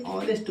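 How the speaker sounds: ऑनेस्ट with silent H; pronunciation demo. Shown as a demonstration. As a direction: open ऑनेस्ट on an "aw" vowel. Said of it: "इसको क्या बोलते हैं?".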